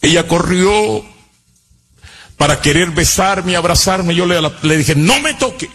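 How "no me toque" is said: anguished reproach. Retold or imitated. Confronts the mother who abandoned him.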